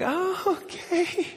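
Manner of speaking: tiny high falsetto; hammed-up scared falsetto; frightened reluctant agreement. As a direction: give in with a squeaky, nervous okay. Scared passenger reluctantly gives in.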